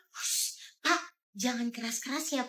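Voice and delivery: soft, breathy shushing; hushed whisper. Acted. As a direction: whisper urgently to keep the secret. Hushing driver to keep secret. (berbisik menyuruh sopir diam)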